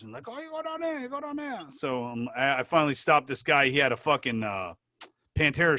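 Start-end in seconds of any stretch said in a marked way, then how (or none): 0.3-1.6 put-on high, soft Japanese-accented helper; light, pitched-up mock Japanese accent. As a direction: imitate a local in high Japanese-accented patter. Mimicking locals giving directions.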